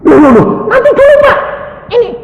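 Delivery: high, loud, flustered protest; mock panicky voice; bewildered nurse. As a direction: blurt it out like a confused nurse. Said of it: "Susternya kan kebingungan".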